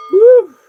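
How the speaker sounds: high loud woo; party whoop; excited whoop. Real, not acted. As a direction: let out a big joyful whoop. Excited whoop mid reading.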